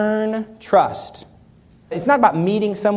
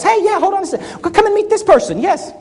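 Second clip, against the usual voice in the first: bright mock-eager handoff, higher; mock eager host; 10 semitones higher than usual. Speaker acting out introducing a stranger, mock eager. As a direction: hurry them along to meet someone. Acting out passing someone along.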